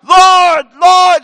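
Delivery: loud high shout; shouting prayer. Retold or imitated. Man up front at Korean prayer meeting, loud shout. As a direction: call out to God at full volume. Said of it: "People were shouting".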